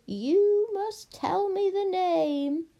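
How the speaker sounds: high, slow, drawn-out command; demanding witch. Acted. Witch orders princess to name her love, insistent.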